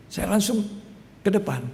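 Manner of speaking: normal talking voice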